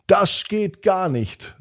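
firmly object, drawing the words out high; indignant policeman. A narrator's reading. Policeman sees driver run red light.

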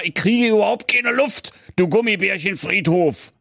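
high, strained wolf voice; squeezed wolf, can't breathe. A narrator's reading.

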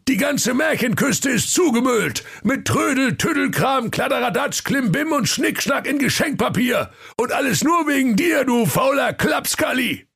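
angry high-pitched holler; hammed-up furious dressing-down, high voice; scolding Santa. A narrator's reading.

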